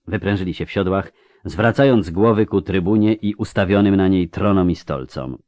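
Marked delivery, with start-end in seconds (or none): none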